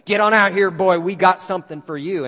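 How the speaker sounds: loud taunting call out to the house; a Klansman shouts at Watts from the front yard, a loud taunting yell. Retold or imitated. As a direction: bellow it from the front yard like a mob. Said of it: "heard them yelling".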